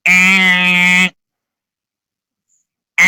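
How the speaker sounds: wrong-answer buzzer sound; a mock buzzer meaning wrong; buzzer noise. Shown as a demonstration. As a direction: mimic a game-show buzzer. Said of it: "I know y'all tired of that noise".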